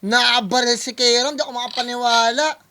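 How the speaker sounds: loud disbelief. Real, not acted. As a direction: exclaim it, stunned by the punchline. Stunned reaction to rap punchline. (malakas na di-makapaniwala)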